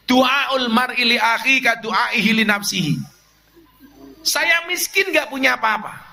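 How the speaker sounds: preacher urging the congregation to honour their teachers, fervent; fervent preaching. Acted. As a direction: preach it with rising fervour to the congregation. Impassioned part of the sermon.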